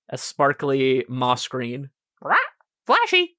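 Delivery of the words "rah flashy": squawking bird. Acted. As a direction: shriek it like a pet bird.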